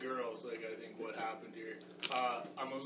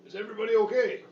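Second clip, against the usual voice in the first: calling out; 8 semitones higher, 13 dB louder, faster than usual. Acted. Calling through door to family.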